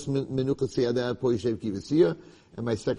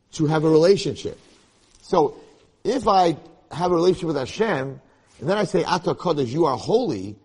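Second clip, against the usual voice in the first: preaching; 7 semitones higher, 8 dB louder than usual. Acted. Preaching peak.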